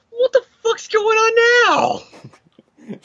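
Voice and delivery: high, drawn-out squawk of bewilderment; Spider-Man. Acted. Overwhelmed hero reacts to the chaos, mock-bewildered high yell. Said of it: "I'm just making Spider-Man like".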